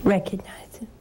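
hushed plea to recognize him; whispered. Real, not acted. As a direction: breathe it to them gently. Whispered exhortation to viewers.

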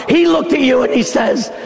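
ordinary talk, nothing marked

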